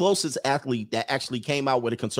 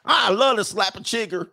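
high mock Southern yokel; 8 semitones higher than usual. Southern local bragging about bugs, hammed-up high yokel voice. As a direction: play the country yokel with relish.

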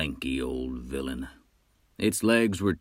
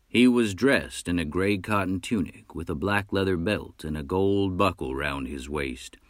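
ordinary delivery, nothing marked

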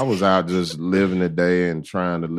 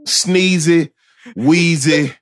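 high cartoon character voice; a hint of an old cartoon character; vintage cartoon voice; 7 semitones higher, 5 dB louder, much slower than usual. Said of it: "You kinda sound like a cartoon character".

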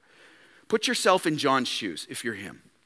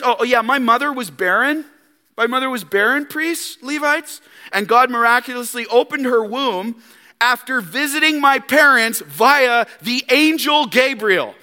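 higher, louder smug bragging; boastful flesh; 7 semitones higher, 4 dB louder than usual. Retold or imitated. John boasting to priests and Levites, smug. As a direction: brag smugly about your pedigree. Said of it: "Doesn't our flesh wanna do this".

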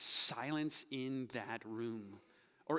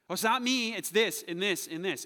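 high, loud acted excuse; mock blame-shifting; 6 semitones higher, 9 dB louder than usual. Sinner excusing behavior like Adam and Eve, mock protest. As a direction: protest loudly and push blame elsewhere. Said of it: "You're playing the blame game".

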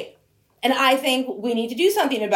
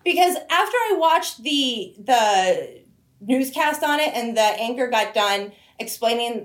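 ordinary delivery

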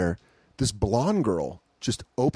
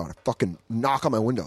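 normal talking voice

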